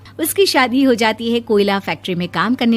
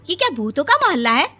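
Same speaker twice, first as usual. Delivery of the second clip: shocked; 7 semitones higher, faster than usual. Acted. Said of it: "Vibha timing सुनकर हैरान हो जाती है".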